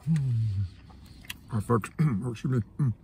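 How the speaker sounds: normal talking voice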